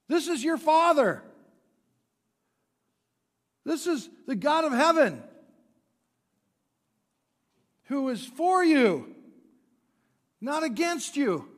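full-force preaching. Acted. Pastor proclaiming to the congregation, full-force preaching. Preacher declares God is for you.

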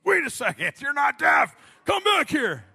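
high, loud shout; angry realization shout. Retold or imitated. Homeless man yells as the driver peels off, high and loud. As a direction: yell after the fleeing driver. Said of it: "He's like".

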